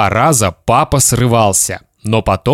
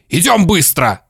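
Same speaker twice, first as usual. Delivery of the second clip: father's shout; 7 semitones higher than usual. Acted. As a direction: hurry the boy along. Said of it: "снова закричал папа".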